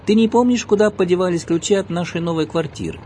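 loud, high calling voice. A narrator's reading. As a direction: shout the question across the yard. Husband calling to his wife.

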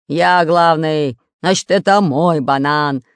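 slow, loud pirate growl. Acted. Pirate claims the banana from the spy, growling. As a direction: growl it slowly, asserting who is boss. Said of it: "рычал Буль-Буль".

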